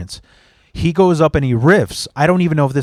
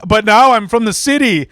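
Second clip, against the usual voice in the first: higher-pitched mocking brag; mock boast; 8 semitones higher, 3 dB louder than usual. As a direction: mimic a suburbanite claiming city cred. Mocks suburban comics' boasting.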